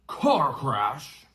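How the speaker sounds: loud roaring outrage; roared. Acted. Giant roars at the relatives in outrage. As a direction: bellow it back at them. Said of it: "roared Hagrid".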